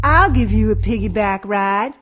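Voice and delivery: loud, looming bully. A narrator's reading. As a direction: call down loudly at a smaller kid. Bully taunts small girl in story.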